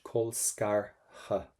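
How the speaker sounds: "Col scar ha" very slow, syllable by syllable; H not TH. Shown as a demonstration. Teacher drilling "Col scar ha" for students, very slow. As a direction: spell out "Col scar ha" slowly with an H. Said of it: "go for the letter H".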